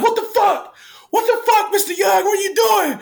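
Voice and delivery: high-pitched, loud imitation of upset kids; kids' shocked outcry. Kids react to his leaving.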